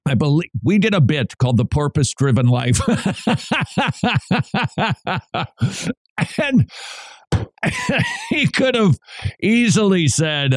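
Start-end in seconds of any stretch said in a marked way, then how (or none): 2.8-5.9 a real laugh that rises, caught off guard. Real, not acted. Laughs at his own joke. 7.3-8.2 a real laugh squeaked out very high; high squeaky laugh. Real, not acted. Laughs at his own joke.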